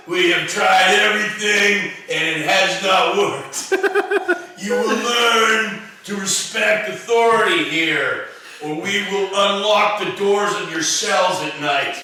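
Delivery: loud mock prison warden; stern warden. Mock warden speech, shouted.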